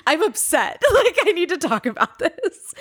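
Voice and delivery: high, whiny fake-tearful voice with laughs; mock crying. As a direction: imitate your own tearful outburst, half laughing. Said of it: "just immediately started crying".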